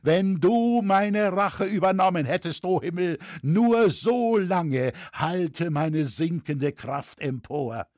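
high, impassioned appeal that sinks at the end; fervent invocation. A narrator's reading. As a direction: call out to heaven with failing strength. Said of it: "rief Lüskirchner und hob die Hände hoch". (inbrünstige Anrufung des Himmels)

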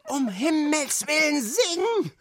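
high, loud wailing plea; desperate yowling. A narrator's reading. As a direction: howl it like a despairing director. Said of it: "jaulte der Regisseur". (verzweifeltes Jaulen)

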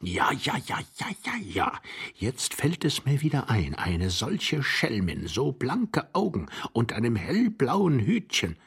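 put-on doddering, swooping old man's voice; delighted old man. Old husband recalling the pretty lady, doddering and delighted. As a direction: reminisce with drawn-out relish.

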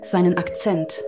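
ordinary delivery

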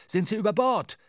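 high, loud anxious question; alarmed shout. A narrator's reading. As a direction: shout it across the deck in alarm. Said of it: "rief Starbuck der Mannschaft zu". (laut und besorgt gerufen)

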